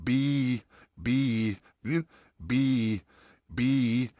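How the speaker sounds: बी with lips normally open, jaw a little down; बी, a straight, even ee; clean ee demo. Shown as a demonstration. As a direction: hold बी for two counts. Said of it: "और आप कहेंगे बी".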